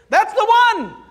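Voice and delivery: high, loud, delighted cry; excited exclamation. Acted. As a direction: burst out with joy. Acted leper confirming the ritual.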